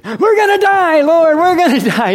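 hammed-up high, loud panic; panicked screaming. Disciples wake sleeping Jesus in boat, mock panicked scream. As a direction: shriek it like terrified men on a sinking boat.

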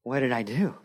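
ordinary delivery

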